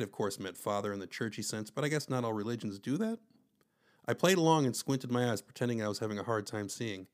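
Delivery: usual speaking voice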